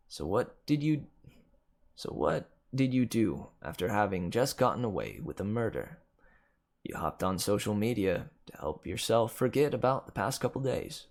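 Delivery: ordinary delivery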